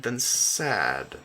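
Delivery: usual speaking voice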